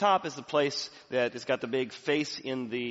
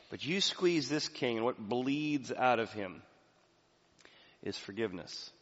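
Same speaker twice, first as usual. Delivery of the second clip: ordinary talk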